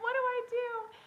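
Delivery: high, loud, frantic what do I do; frantic plea. Retold or imitated. Mom on the phone begging her husband for help, frantic. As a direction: beg for help in a retold panic. Calling Joe at work.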